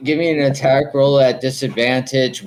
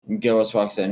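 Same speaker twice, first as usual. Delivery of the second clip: normal talking voice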